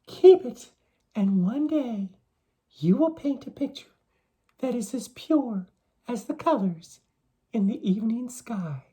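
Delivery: high maiden voice fading to a whisper; young maiden's voice. A narrator's reading. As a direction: start high and bright, then breathe the end. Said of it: "she told them".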